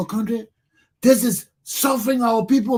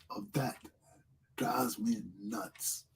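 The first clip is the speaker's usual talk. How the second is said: lower, much softer, slow whisper; 10 semitones lower, 16 dB quieter, slower than usual. Real, not acted. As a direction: drop to a seething whisper. Rant drops to a whisper.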